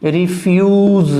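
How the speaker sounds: रिफ्यूज said slowly, "ri-fyooz", joining the sounds; careful demo of रिफ्यूज; slow blended pronunciation. Shown as a demonstration. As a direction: blend रिफ्यूज together slowly at the end of spelling it. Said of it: "और फिर ज".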